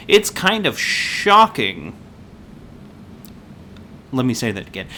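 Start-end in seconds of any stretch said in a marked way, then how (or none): 0.1-1.9 slow, higher, with SHOCKING drawn out for the joke; milk the pun on "shocking", stretching it out; punning stress. Real, not acted. Pun delivered at the dramatic reveal.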